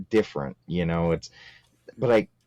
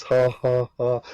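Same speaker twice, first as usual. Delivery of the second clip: raspy mock cackle, higher; imitating smoker's laugh; 7 semitones higher, slower than usual. Podcast host imitating the saleswoman's smoker's laugh, mock cackle. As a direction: ham up the lady's smoker laugh. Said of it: "When she starts laughing".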